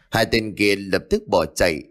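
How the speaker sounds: ordinary delivery, nothing marked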